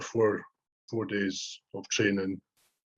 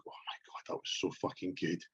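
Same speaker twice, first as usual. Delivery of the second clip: hushed, breathy reverence over the last bite; mock-reverent hushed voice; awed whisper; 3 semitones lower, 11 dB quieter than usual. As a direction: whisper it like a sacred moment. Savouring last mouthful of diet food.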